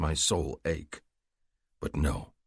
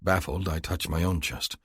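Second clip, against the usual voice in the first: ordinary delivery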